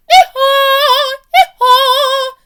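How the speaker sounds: very high, loud sung hee-haw; a big braying donkey call. Acted. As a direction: bray it out like a donkey. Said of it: "The donkey brayed".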